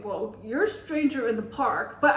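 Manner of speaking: ordinary delivery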